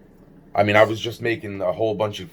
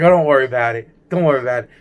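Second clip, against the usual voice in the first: mock reassurance; 4 semitones higher, 6 dB louder, much faster than usual. Alfredo reassures while dropping thing.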